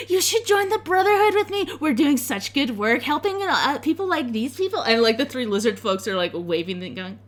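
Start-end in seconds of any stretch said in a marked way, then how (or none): 0.1-4.8 high, gushing recruiter; excitable high girlish voice; eager tiefling girl. Acted. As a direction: pitch it with squealing enthusiasm. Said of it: "grabs onto Zelphine's hands".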